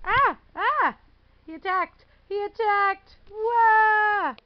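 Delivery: real startled cry at the cat; startled shout. Real, not acted. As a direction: yelp as the cat pounces. Cat attacks owner.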